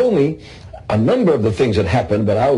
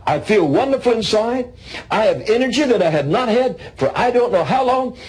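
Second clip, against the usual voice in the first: joyful healed man; 9 semitones higher than usual. Retold or imitated. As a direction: praise it out, lifted high with joy. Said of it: "He said".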